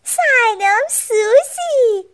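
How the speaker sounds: high, drawn-out cheerful hello; happy greeting. A narrator's reading. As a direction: greet her brightly and slowly. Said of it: "با خوشحالی گفت".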